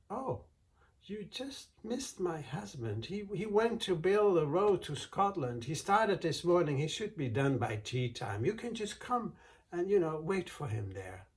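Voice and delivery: high, sugary, innocent voice; sweet innocent wife. Acted. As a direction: play it sweet and harmless to fool him. Wife sweetly deceiving the giant.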